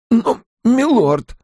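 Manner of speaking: high, drawn-out protest. A narrator's reading. Innkeeper objects to being sent away, protesting. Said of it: "протестовал Куале".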